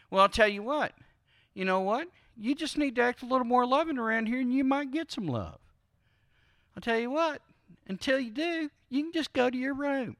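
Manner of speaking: hammed-up stern spouse giving orders; mock scolding spouse. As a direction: talk down to them like a child sent to their room.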